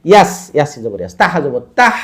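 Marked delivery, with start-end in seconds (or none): 1.8-1.9 তাহ ending in an audible throat h; throaty final h. Shown as a demonstration. Teacher showing the throat held, breathy h closing তাহ. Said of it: "গলাটা টেনে ধরবে".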